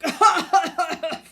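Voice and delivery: loud high hacking cough. A narrator's reading. Sick son coughing into the phone. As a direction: hack out a fit of coughs. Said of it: "Coughed a voice".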